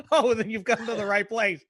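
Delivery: ordinary delivery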